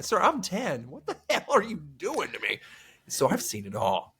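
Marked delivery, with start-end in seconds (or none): 0.0-2.6 put-on higher-pitched, incredulous kid protesting; mock-indignant child, pitched up. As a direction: retell it as a baffled kid objecting.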